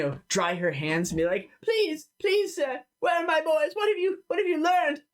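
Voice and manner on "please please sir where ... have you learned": desperate, very high-pitched begging; pleading mother. Acted. Mother begs stranger for news of her boys, high desperate plea. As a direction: beg as a frantic mother.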